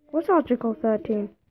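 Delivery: normal talking voice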